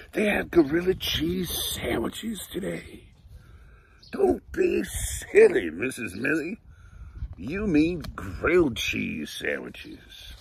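lively, bright read-aloud storytelling; reading to children. Acted. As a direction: read it like story time for little kids. Reads picture book aloud.

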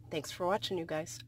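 usual speaking voice

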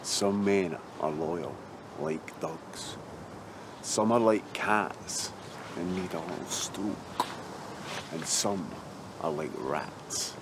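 slow, weighty pronouncement; solemn, measured. Acted. Lecturing his mate about loyalty, slow and measured. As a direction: spell it out slowly, with long pauses.

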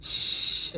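"স-" as a bare "shhh" of air; breathy SH hiss. Shown as a demonstration. Teacher showing the sound of shin, airy hiss. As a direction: push air out in a long sh. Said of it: "বাতাস বের করবো".

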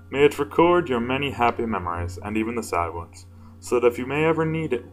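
ordinary delivery

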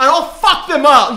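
mock threat. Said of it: "They're like".

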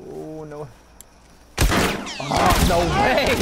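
stretched-out loud disbelief; a loud, drawn-out no way; shocked outcry. Real, not acted. Teammate dies in firefight.

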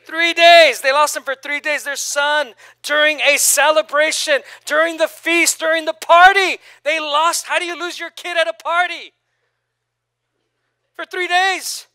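loud, high, building preacher's call; preaching at full force. Real, not acted. Preacher pressing his congregation, loud and high.

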